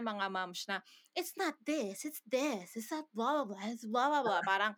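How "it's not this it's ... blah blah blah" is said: mimicking a pedantic TikTok pronunciation coach; put-on, sing-song know-it-all dictation; mock self-convinced corrector. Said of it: "convinced na convinced siya sa sarili niya". (ginagayang bidang-bidang nagtuturo ng bigkas)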